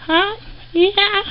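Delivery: short high coo. Real, not acted. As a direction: coo back at the rat. Baby talk to pet rat.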